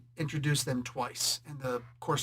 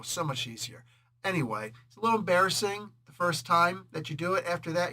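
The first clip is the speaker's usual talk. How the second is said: ordinary talk, nothing marked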